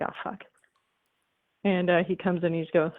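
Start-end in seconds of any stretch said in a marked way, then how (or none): 0.0-0.4 hushed dread. Acted. Wife caught, husband comes home.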